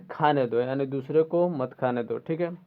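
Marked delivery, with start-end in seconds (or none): none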